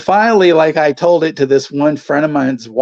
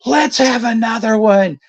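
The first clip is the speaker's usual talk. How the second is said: high mock yell out the window; mother taunting the other driver, yelling; 10 semitones higher, 3 dB louder than usual. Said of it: "She yells out".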